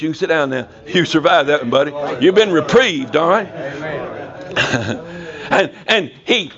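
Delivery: usual speaking voice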